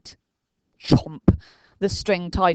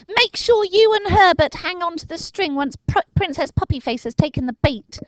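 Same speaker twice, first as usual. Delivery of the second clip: put-on high, louder boy's orders; brief the team urgently; Dinking instructing; 13 semitones higher, 5 dB louder than usual. A narrator's reading. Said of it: "he said".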